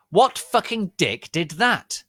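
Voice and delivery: high, loud angry yell; angry demand. Acted. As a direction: demand who did it. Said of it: "angry question".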